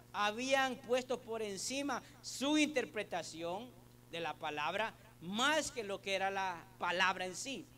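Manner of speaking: loud, driving sermon delivery; full-force preaching. Acted. Preacher urges the church on the meaning of mourning, loudly. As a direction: preach it out to the congregation.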